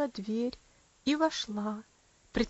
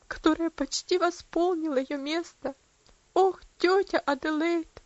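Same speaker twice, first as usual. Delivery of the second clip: trembling voice; 5 semitones higher, 4 dB louder than usual. A narrator's reading. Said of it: "сказала она дрожащим голосом".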